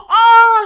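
high, loud "oh" wail; hammed-up squealed gasp of dismay; mock shocked cry. Acting out her shock on a call.

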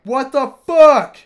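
loud, high, drawn-out yell of disgust; retold shocked shout. Soaked in his date's friend's vomit, he yells in disbelief. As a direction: yell it like you're drenched in puke. Shocked as puke soaks him.

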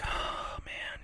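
very soft, voiceless sigh of defeat; imitated breakdown. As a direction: sigh it out, mimicking a man crumbling. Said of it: "like, breaks down".

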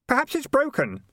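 very high, helpful suggestion; innocently obliging high voice. A narrator's reading. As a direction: suggest it helpfully, pitched right up. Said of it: "said Keith helpfully".

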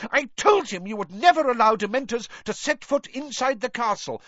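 high, loud furious tirade; storming. A narrator's reading. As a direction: keep raging at the minister. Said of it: "stormed Professor McGonagall".

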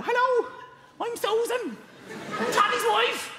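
very high comic Irish woman's voice; Irish wife. Acted. Irish wife saying hello, high Irish falsetto. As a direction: squeak out an Irish greeting. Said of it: "This is my wife".

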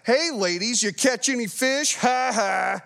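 mock taunt ending in a laugh; teasing. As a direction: rib the fishermen playfully. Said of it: "I couldn't stop laughing at this".

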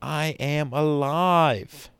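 slow rising cry of being alive; building scream. Shown as a demonstration. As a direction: start steady, then lift it up. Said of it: "I want you to scream".

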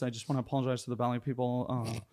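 fast, soft mock apology video voice; cringe apology. He mocks his own apology video with fake sincerity. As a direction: rattle off a fake-sincere sorry. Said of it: "Like cringe ass shit like that".

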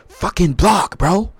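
high, loud mock quarterback outburst; Patrick Mahomes. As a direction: yell it like a fed-up quarterback at his lineman. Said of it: "how to talk in Patrick's voice".

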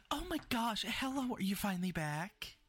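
high, gushing mayor voice; excited mayor. Acted. Mayor running over to the returning party, high and excited. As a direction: greet them like a relieved, beaming mayor. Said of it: "Just like".

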